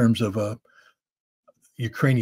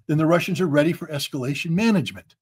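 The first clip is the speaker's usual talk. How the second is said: usual speaking voice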